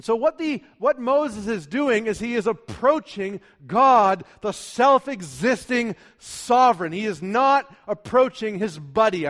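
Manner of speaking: high, loud, emphatic pulpit voice; forceful preaching. Real, not acted. Preacher telling church how Moses approaches God, forceful preaching. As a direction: drive each point home to the congregation.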